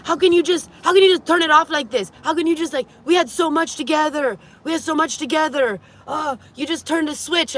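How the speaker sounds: mock the ex's hurt pleading; over-the-top whining ex-boyfriend; whiny pleading ex. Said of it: "And he goes".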